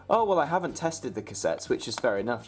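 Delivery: ordinary talk, nothing marked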